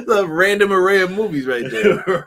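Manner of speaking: loud talk through laughter; laughing. Real, not acted. Host amused by his own odd movie list, laughing. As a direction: crack up while saying it.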